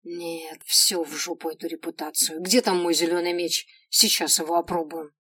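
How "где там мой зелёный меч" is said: ordinary delivery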